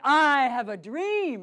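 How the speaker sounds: loud, slow fiery oration; fiery orator. Shown as a demonstration. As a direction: proclaim it with fire in the belly. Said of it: "we wouldn't remember that speech".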